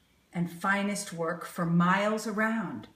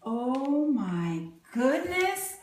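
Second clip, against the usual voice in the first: plain reading voice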